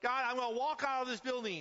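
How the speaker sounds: high, loud desperate plea; crying out to God. Retold or imitated. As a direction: beg God at the top of your voice. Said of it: "And he goes".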